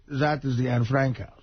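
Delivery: put-on low German-accented tour guide. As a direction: announce a landmark as a German guide. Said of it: "he has that accent".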